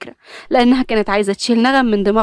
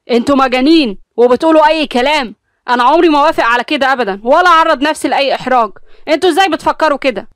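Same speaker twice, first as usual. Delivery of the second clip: loud, heated argument; angry shouting; 6 semitones higher, 5 dB louder than usual. Acted. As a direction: shout over each other. Heated family quarrel.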